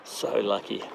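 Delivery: choked-up hush. Real, not acted. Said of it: "pretty emotional right now".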